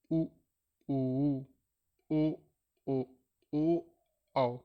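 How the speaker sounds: उ ऊ ओ with lips rounded, drawn out; teacher showing rounded vowels; rounded vowel demo. Shown as a demonstration. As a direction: round your lips and hold उ ऊ ओ. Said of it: "जैसे देखो".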